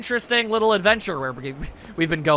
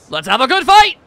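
high, loud dojo greeting; yelling; 5 semitones higher, 10 dB louder than usual. Acted. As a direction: yell it like entering a judo match. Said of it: "why are you yelling?".

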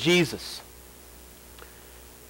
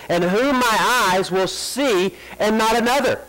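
fervent scripture reading; 4 semitones higher than usual. Acted. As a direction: proclaim the verse with rising fervour. Reads Job aloud with force.

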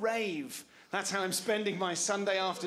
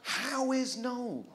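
baffled drawn-out question; 3 semitones higher, much slower than usual. Acted. Baffled slow repeat of question.

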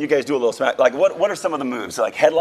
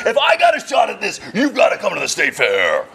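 wrestling announcer voice; 4 semitones higher than usual. Acted. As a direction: sell the fight like an announcer. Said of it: "that deep voice of yours".

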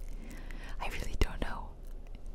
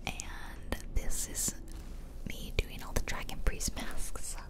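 whispered ASMR. Real, not acted. Player whispers to viewers about the speedrun, ASMR whisper. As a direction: whisper the commentary, no voice at all. Whispered game commentary throughout.

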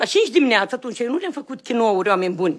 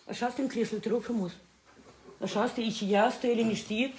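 much softer, lower voice; hushed settling; 4 semitones lower, 14 dB quieter than usual. Real, not acted. Parent asking someone to sit still, hushed settling. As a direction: settle them down quietly.

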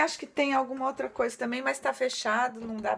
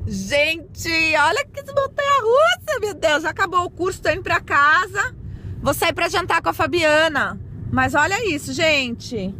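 excited; 5 semitones higher, 8 dB louder than usual. Real, not acted. Thrilled by the mini park.

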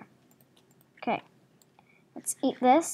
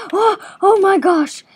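high, loud panicked shout of shock; oh my gosh screamed after a near miss; startled shriek; 9 semitones higher, 14 dB louder, slower than usual. Real, not acted. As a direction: yelp in real alarm. Ogre hammer nearly hits her.

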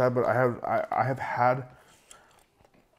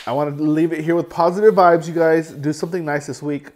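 upbeat send-off; 4 semitones higher, 8 dB louder than usual. Real, not acted. Upbeat sign-off to viewers.

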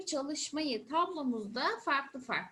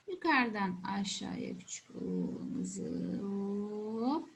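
slow writing demo; 6 semitones lower, 4 dB quieter, slower than usual. Shown as a demonstration. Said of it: "shows how to write the letter".

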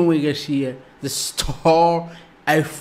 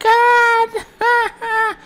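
high laughing sob; 7 dB louder than usual. Real, not acted. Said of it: "I am crying here".

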